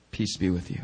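gentle peace. Retold or imitated. Jesus greeting frightened disciples, soft and low. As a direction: say it softly, calming them. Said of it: "and said to them".

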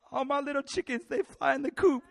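high, mock weepy wail; hammed-up crying voice; imitated tearful dad. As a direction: blubber like a sentimental father. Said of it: "he started crying one night".

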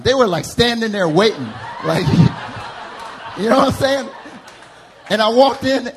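ordinary talk, nothing marked

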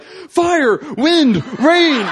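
high, loud shouts, word by word; yelling. Retold or imitated. Excited first-timer throwing out words in worship, yelling. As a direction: hurl out each word as a cry. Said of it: "I'm yelling out all sorts of stuff".

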